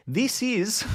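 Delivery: put-on high-pitched, unsure stab at a New Zealand accent; attempted New Zealand accent. Streamer introducing a bollard, failed New Zealand accent. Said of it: "I don't know what the New Zealand accent is".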